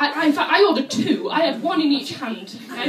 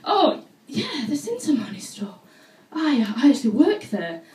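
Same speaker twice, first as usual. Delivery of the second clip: keen, flirty, laughing; eager flirting; 4 dB louder than usual. Retold or imitated. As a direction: jump at the chance to help the handsome man. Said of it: "nice tall European man".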